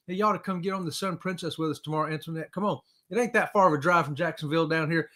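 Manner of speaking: ordinary delivery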